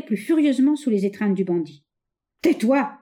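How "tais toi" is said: louder, higher angry command; raging shout. A narrator's reading. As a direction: bark at her to shut up. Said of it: "s'écria-t-il avec rage".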